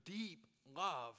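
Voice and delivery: ordinary talk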